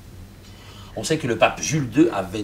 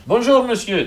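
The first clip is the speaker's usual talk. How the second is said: absent-minded; 12 semitones higher, 7 dB louder than usual. Acted. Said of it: "dit distraitement le pape".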